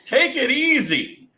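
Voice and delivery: high, slow teasing scold; scolding brunch drinkers, teasing; mock scolding. Real, not acted. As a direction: stretch it out in disbelief. Scolding morning mimosa drinkers.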